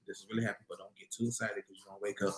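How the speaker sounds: hushed, low inner voice; calm self-talk. Shown as a demonstration. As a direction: talk yourself down very softly. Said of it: "You have to learn to be like".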